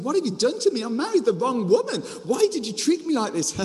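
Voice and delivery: high-pitched, indignant protest; acted dismay. Jacob confronting Rachel's dad, mock outraged dismay. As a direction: play the cheated bridegroom demanding answers. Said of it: "Can you imagine the disappointment?".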